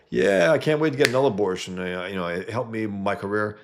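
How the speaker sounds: high, loud scornful imitation, easing off; exaggerated, gleeful mock boast; mocking quote. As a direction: mimic someone bragging shamelessly. Mockingly quotes women he despises.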